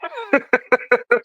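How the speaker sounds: bursts into high laughter; high-pitched amused laugh. Real, not acted. Laughing at old man.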